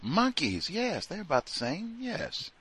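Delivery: high soft parody of the wife; lilting mock agreement. Wife agreeing about monkeys in a high soft mock voice. Mocking the wife's view again.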